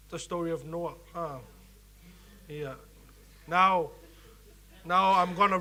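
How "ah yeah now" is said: usual speaking voice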